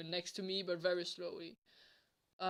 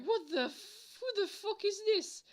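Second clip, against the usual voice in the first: retold startled disbelief; 12 semitones higher than usual. Waking to find a girl watching him, startled. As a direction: squeak out a bewildered reaction.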